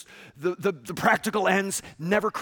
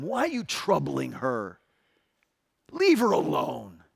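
Jesus rebuking; 6 semitones lower, 3 dB quieter than usual. Retold or imitated. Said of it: "That's what I would have said".

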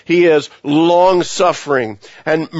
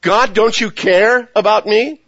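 higher pleading cry to God; anguished plea; 6 semitones higher than usual. Retold or imitated. A sufferer crying out to God, higher and pleading. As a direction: beg God like you feel abandoned. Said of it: "Think about that".

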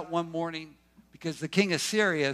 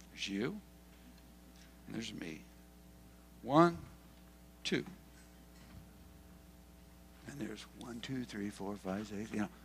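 hushed counting; 6 semitones lower, 13 dB quieter than usual. Acted. Elijah counting quietly to servant.

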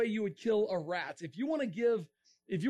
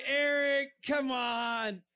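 drawn-out, raised-voice objection from a sceptical crowd; incredulous protest; 3 semitones higher, much slower than usual. Retold or imitated. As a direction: protest in mock disbelief, stretching the words. Voicing listeners' disbelieving protest.

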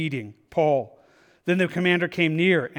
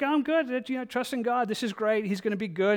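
lighter and higher, softly unbothered; breezy carefree refusal; 4 semitones higher, 5 dB quieter than usual. Acted. Stubborn man shrugs off fleeing neighbors, carefree and softer. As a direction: brush off the warning, all smiles. Man declining boat rescue.

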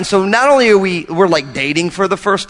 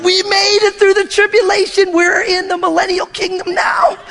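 triumphant cheer; 14 semitones higher than usual. Retold or imitated. Couple celebrating their anniversary, high triumphant shout. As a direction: crow it like you survived a war. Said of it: "we're like".

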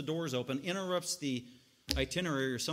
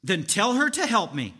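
bossy demand; 2 semitones higher, 8 dB louder than usual. Retold or imitated. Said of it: "she says".

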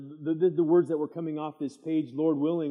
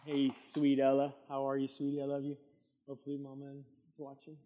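tender greeting; 6 dB quieter than usual. Real, not acted. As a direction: coo gently to a newborn. Greeting baby granddaughter on camera.

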